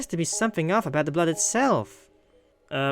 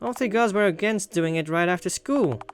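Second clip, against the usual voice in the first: ordinary talk, nothing marked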